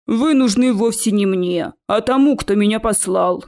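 a heavy, deliberate creature voice; troll voice. Acted. Troll explains to the students who it wants, lumbering.

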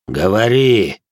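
drawn-out, forceful order; commanding. A narrator's reading. Possessor interrogates the captive in the chrysalis, commanding. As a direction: order it, stretching the word. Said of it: "приказал мой вселенец".

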